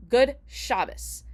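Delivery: slow "Good Shabbos", SHAB stressed, second syllable light; first-syllable stress. Shown as a demonstration. People replying on the street, "Shabbos" stressed on SHAB. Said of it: "putting the emphasis on the first syllable".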